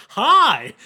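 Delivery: high bright shouted greeting; loud high hi; loud child hi. Retold or imitated. Child wanders in, says hi.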